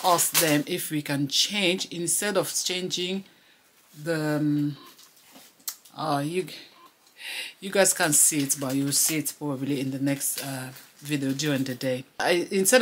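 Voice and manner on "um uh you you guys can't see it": ordinary talk, nothing marked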